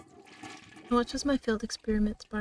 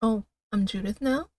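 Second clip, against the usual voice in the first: ordinary delivery, nothing marked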